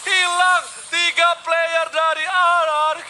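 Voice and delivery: high, loud hype call; fighting game announcer. Acted. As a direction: roar the team wipe. Hyped esports casting.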